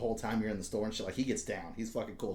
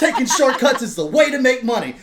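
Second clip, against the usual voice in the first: cheesy ad voice; 12 semitones higher, 14 dB louder than usual. Retold or imitated. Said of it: "it's like an ad that's talking to you".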